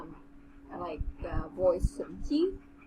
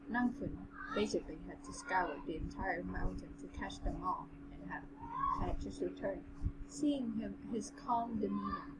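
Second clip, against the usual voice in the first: measured, even audiobook read; story narration; 9 dB quieter than usual. A narrator's reading. Narrator reading a fantasy novel to the co-host, story narration. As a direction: read the tale aloud with composure.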